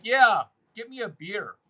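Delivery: lightly acted pushy guy; imitating a jerk. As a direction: demand it like a freeloading dick. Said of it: "if the guy is a dick and poor".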